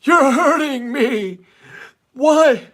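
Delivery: very high, loud, slow crying whine; Jeff the Drunk impression. Host doing Artie's Jeff the Drunk for a viewer, high crying whine. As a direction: wail it like a weepy drunk. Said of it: "Can you do an impression of Artie Lange's impression of Jeff the Drunk?".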